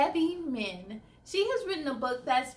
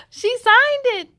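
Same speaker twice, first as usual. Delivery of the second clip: thrilled, much higher and louder; excited; 11 semitones higher, 13 dB louder than usual. Real, not acted. Host showing kids the signed book, thrilled. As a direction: burst out with real delight.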